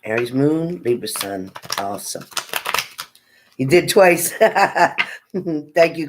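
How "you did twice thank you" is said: thanks bubbling with laughter; laughing. Real, not acted. Host laughing as a viewer likes twice, amused thanks.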